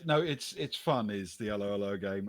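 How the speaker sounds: normal talking voice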